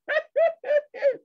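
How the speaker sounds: high-pitched laugh; high chuckle after mimicry; mocking laugh. Real, not acted. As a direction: laugh at your own impression.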